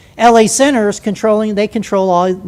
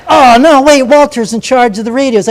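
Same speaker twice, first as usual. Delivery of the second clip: loud, higher-pitched mock dismay; alarmed inner thought; 4 semitones higher, 7 dB louder than usual. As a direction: act out a sudden inner objection. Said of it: "And I thought".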